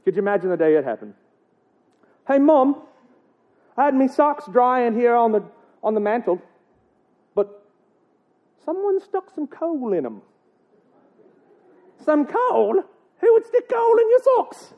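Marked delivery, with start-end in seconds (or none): 2.3-10.2 put-on high Irish child's complaint; mock Irish accent. Child tells Mom about coal in the socks, mock Irish accent. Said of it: "I'm gonna get that accent". 12.0-14.7 very high, outraged Irish mother; mock Irish accent. Mother reacts to coal in socks, shrieky mock Irish accent. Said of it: "I'm gonna get that accent".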